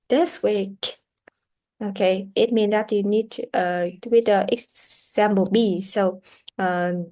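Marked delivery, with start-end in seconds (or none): none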